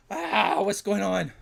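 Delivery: sudden loud, high startled cry; what just happened to my stream. Real, not acted. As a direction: caught off guard, blurting it out. Stream suddenly breaks mid-giveaway.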